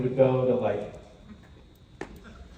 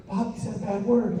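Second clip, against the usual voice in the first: high child voice, lightly imitated; tearful little girl; 9 semitones higher than usual. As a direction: imitate an upset small daughter tattling. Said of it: "she came to me crying".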